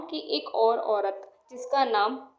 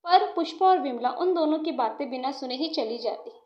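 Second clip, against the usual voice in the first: ordinary delivery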